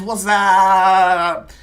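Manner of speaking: loud, stretched-out "wassup" holler; drawn-out greeting. Acted. Streamer reading out a viewer's chat greeting, loud drawn-out holler. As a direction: holler it and drag out the last vowel. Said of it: "He says".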